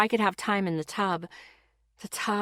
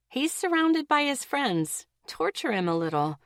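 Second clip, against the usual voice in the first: much higher, mischievous He's surrounded by his friends; devilish inner voice; 7 semitones higher than usual. A narrator's reading. Her twisted side urging her to tease him, high and mischievous. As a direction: tease it like a little devil on the shoulder. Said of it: "my twisted side was saying".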